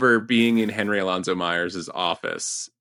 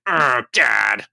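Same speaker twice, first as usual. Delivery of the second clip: exasperated groan at a parent; mock sulky child, louder on the groan; petulant; 3 semitones higher, 5 dB louder than usual. Son to his omnipotent father, petulant. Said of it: "he was just so petulant and".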